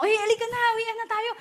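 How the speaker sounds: high, eager call of a friend heading home; a friend calling at the end of the VIP event, high and eager; friend calling to leave. Retold or imitated. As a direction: urge them to go home now, pitched high.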